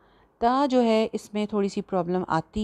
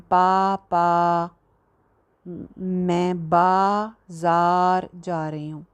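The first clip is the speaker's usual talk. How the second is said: पापा called out louder, each aa dragged very long; slow drawn-out call to papa; overlong vowels; 3 dB louder, much slower than usual. Shown as a demonstration. Teacher mocking overlong vowels in a call to papa. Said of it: "ऐसे तो नहीं कहते ना".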